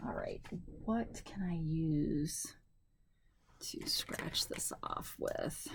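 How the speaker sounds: slow, trailing into a whisper. Real, not acted. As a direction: fade to a whisper while searching. Wondering aloud while searching.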